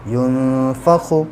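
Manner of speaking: slow recitation of युनफ़ख़ु; Quranic recitation. Shown as a demonstration. Teacher spelling out a word for students, slow recitation. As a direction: recite युनफ़ख़ु slowly, ख़ clear. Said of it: "यौम युनफ़ख़ु".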